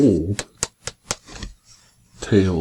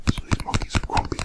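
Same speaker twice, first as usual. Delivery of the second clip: fast whisper; 7 dB quieter, much faster than usual. Real, not acted. Quick whispered aside to child.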